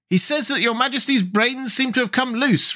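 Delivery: put-on high-pitched, demure girl. A narrator's reading. Girl translates for the king, demurely. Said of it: "replied the girl demurely".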